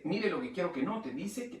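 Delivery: usual speaking voice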